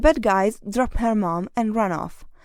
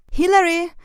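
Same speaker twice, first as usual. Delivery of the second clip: high, loud call of her name; mom calling out; 8 semitones higher, 5 dB louder than usual. A narrator's reading. Mom calls Hilary to help, high and loud. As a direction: call her daughter sharply from another room. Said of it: "said her mom".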